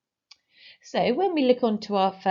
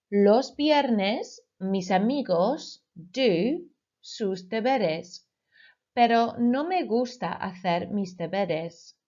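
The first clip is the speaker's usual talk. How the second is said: slow dictation; slower than usual. Shown as a demonstration. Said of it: "I will say the Spanish sentence twice".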